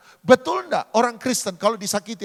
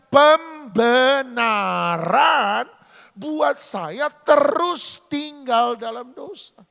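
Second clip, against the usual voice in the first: very slow, drawn-out, higher; slow emphatic; 5 semitones higher, 4 dB louder, much slower than usual. Real, not acted. Preacher driving home the sermon point slowly. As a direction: spell out each word heavily. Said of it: "slow emphatic moral".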